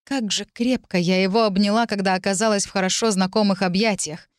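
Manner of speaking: ordinary delivery, nothing marked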